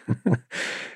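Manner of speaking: soft, breathy real chuckle; a quick quiet laugh to yourself; short chuckle. Real, not acted. Speaker amused by "Now do you believe me?", soft chuckle. Amused after his quote.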